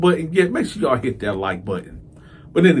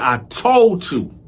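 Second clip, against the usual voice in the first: exasperated scolding; 9 semitones higher than usual. Retold or imitated. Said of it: "I said".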